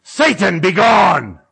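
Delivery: loud mock exorcism shout; booming prophet's rebuke, played for laughs; exorcist's command. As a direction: cast out the devil at full voice. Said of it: "I turn to Nikki and I go".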